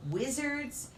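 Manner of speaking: ordinary delivery, nothing marked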